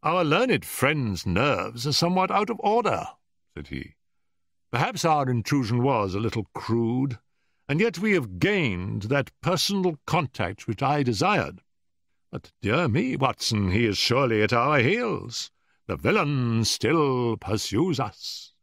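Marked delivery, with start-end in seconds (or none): 0.0-3.1 higher, wryly understated Holmes; dry amusement at the other man's temper; amused. A narrator's reading. Holmes comments on the episode to Watson, amused. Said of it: "Holmes seemed greatly amused". 4.7-18.5 higher, lively, playful commentary; amused Holmes. A narrator's reading. Holmes tells Watson they are being pursued, amused. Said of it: "Holmes seemed greatly amused".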